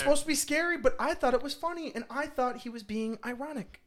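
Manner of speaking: high whiny voice; squeaky defensive fan voice; mock whiny fan.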